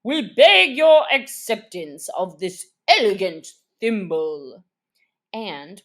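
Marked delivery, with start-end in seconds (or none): 0.0-4.6 louder, formal ceremonial tone; solemn presentation. A narrator's reading. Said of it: "solemnly presented".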